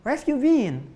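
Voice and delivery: high, put-on English accent in English. Preacher showing the congregation how Indonesians sound English, in a put-on English accent. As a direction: play an Indonesian mimicking a native English speaker. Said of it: "kayak orang Inggris".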